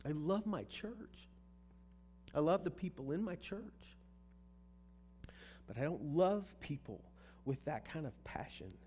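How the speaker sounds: plea rising in pitch; heartfelt prayer. Retold or imitated. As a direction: confess it to God, slowed on the love. Pouring my heart out to God.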